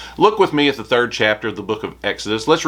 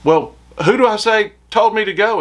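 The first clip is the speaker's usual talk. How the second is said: high, whining objection as Moses; reluctant Moses; 10 semitones higher, 4 dB louder than usual. Acted. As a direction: play Moses pushing back on God, pitched up. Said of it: "Can't you just hear it?".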